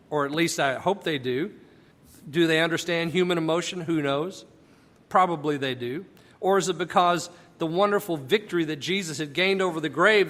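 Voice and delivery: ordinary delivery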